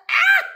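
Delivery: sharp high gasp after a gulp of water. Real, not acted. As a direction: gasp out loud, high and sudden. Gulps water, gasps.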